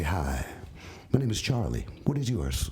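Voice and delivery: low, smooth pick-up line; suave. Acted. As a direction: play the suave romancer introducing himself. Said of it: "I'm suave and say".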